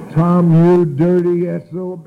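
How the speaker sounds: loud, high, drawn-out curse; acted outrage. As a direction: cuss out a friend who tricked you. Acts out outrage at iced tea.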